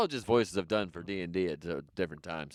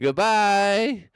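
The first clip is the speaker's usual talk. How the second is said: loud cheery goodbye; 10 semitones higher, 9 dB louder than usual. Real, not acted. Sign-off to audience.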